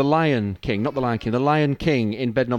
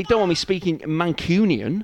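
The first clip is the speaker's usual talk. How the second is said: usual speaking voice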